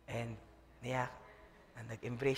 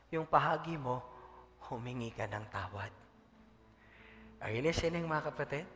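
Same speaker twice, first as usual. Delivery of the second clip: ordinary talk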